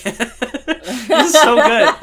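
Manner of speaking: high, loud, laughing gush; loud giggly rave; laughing delight. Retold or imitated. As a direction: squeal it through laughter. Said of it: "and I'm like".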